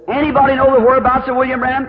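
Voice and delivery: high, loud public announcement; announcer's call. Retold or imitated. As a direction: page the whole crowd, high and loud. Acted announcer paging again.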